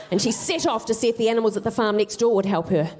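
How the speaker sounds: usual speaking voice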